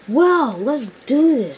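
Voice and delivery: high, drawn-out "well"; acted eager readiness; game resolve. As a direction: gear up for the job, slow and high. Percy readies himself for the job.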